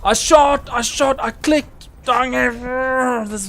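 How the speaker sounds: high-pitched, loud outburst at lag; indignant gamer complaint; exasperated protest. Real, not acted. Protesting lag ruined his shot.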